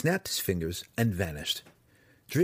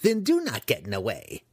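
higher, exasperated wizard, moderately forceful; screamed outburst; 4 semitones higher, 3 dB louder than usual. Acted. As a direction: snap a warning at intruders. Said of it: "he screamed suddenly".

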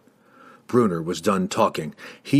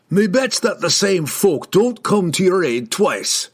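slow, higher, emphatic dwarf grumble; scolding dwarf; 8 semitones higher, 3 dB louder than usual. A narrator's reading. Dwarf rebukes ungrateful riders, gruff scolding. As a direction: scold them slowly as an offended dwarf.

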